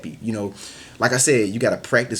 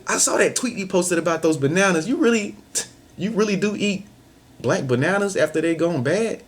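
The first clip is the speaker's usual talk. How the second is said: higher playful teasing voice; playful mock teasing, much higher; teasing friend; 7 semitones higher than usual. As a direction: tease them about the tweet, voice pitched up. Teasing a friend about a tweet.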